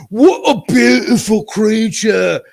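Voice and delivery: high, loud, slow British gush over the dog; British accent. Retold or imitated. Bar owner gushing over the narrator's dog, in a hammed-up British accent. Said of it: "big fat British guy with this thick accent going like".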